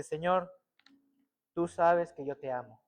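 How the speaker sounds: low, soft, understated sorrow; tearful confession. Retold or imitated. Preacher recalls crying to the Lord, low and subdued. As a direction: retell a tearful plea, subdued. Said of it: "me puse a llorar y le dije".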